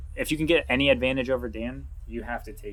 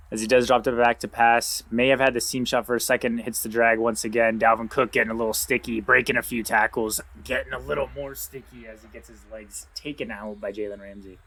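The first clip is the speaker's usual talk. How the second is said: brisk sports call, a bit louder; live play-by-play; 5 dB louder than usual. Acted. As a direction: call the action as it unfolds.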